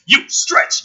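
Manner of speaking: sharp high coach command; barking fitness coach. Retold or imitated. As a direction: bark it like a drill coach. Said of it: "He's just like".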